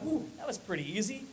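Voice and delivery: very soft, quick self-satisfied murmur; hushed smug little woo; pleased inner voice. Retold or imitated. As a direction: think it to yourself, barely aloud. Thought voiced quietly.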